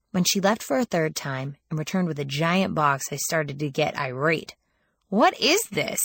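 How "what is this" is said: sharp, higher demanding question. Acted. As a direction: snap it out, irritated. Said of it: "I demanded".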